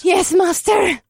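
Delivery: high, slow unison shout. A narrator's reading. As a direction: shout back in obedience. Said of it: "My coven shouted".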